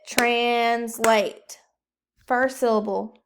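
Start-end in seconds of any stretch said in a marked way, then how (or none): 0.1-1.6 Translate split into two loud beats; clapped syllables. Shown as a demonstration. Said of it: "Clap it".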